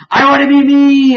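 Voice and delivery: very high, loud mock wail; wailing robot Morty. Robot Morty gaining sentience, wailing. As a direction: cry it out as a hammed-up squeal. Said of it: "Robot Morty's like".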